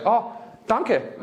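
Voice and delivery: a learner proudly trying out danke; wife's careful danke. Retold or imitated. Wife thanking mom-in-law in shy learner German. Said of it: "she was like".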